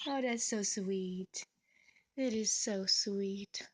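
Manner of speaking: drawn out and hushed, slow. Real, not acted. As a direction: sigh it out in awe, slowly. Melting over bird's kiss.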